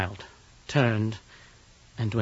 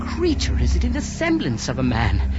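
terrified; 8 semitones higher than usual. Acted. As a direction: voice climbing into dread. Harker in terror at the window.

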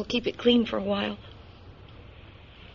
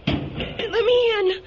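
high, slow frantic begging; desperate plea; 12 semitones higher, 5 dB louder, much slower than usual. Acted. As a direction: beg to get in. Wounded woman begs to board.

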